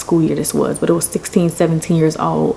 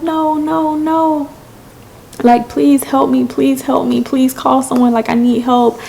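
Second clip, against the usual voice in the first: high-pitched begging, repeated urgently; tearful pleading, retold; 7 semitones higher than usual. Stranded girl begging a trucker, mock tearful plea. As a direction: imitate begging through tears, pitched up. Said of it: "through my tears".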